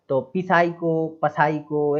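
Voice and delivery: 'पिसाइको, पसाइको' slowly, with the P sounded; wrong pronunciation. Shown as a demonstration. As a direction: drag out a voiced P before 'साइको'. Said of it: "ये इस तरीके से नहीं बोलना है". ('पिसाइको, पसाइको' में P बोलकर धीमा गलत उच्चारण)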